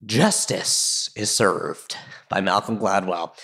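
low, slow, grand pronouncement; mock-solemn verdict. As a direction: declare it like a weighty ruling, drawn out. Mock-solemn verdict after retold ruling.

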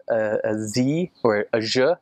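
R buzzed almost like a Z; Z-like R sound. Shown as a demonstration. Explaining the Costa Rican R, buzzing it like a Z. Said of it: "almost like a Z".

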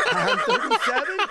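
high, laughing squeal of disbelief; shocked disbelief. Acted. Boyfriend learns her real number.

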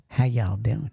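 low, gentle Southern greeting; soft Southern accent. Shown as a demonstration. Trucker showing how soft the South sounds, Southern accent. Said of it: "the Southern accent is soft".

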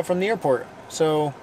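ordinary delivery

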